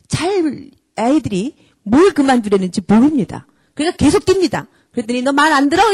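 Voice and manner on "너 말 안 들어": louder scolding threat; mock telling-off of a child; angry parent. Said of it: "이러고 또 때립니다".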